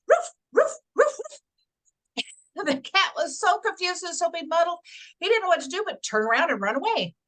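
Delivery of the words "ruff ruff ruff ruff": sharp high yapping barks; barking dog. Acted. Mama mouse scaring off the cat with barking. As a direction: bark like a big fierce dog. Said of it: "stands as tall as she could and goes".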